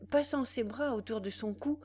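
ordinary delivery

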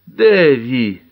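moaning. A narrator's reading. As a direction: moan the name in distress. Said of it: "простонала тётя". (со стоном, умоляюще)

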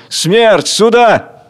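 high, loud savage summons; ferocious shout. Acted. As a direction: call Death forth fiercely. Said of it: "кричит свирепо". (свирепый крик)

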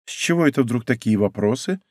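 plain reading voice

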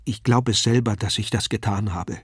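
ordinary delivery, nothing marked

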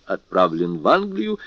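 ordinary delivery, nothing marked

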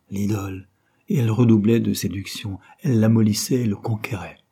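plain reading voice

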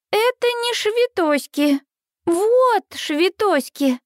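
put-on high, slow little girl insisting on "швитоськи"; a tiny child putting her foot down; stern toddler voice. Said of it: "Минни грозно сдвигает брови".

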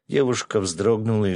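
ordinary delivery, nothing marked

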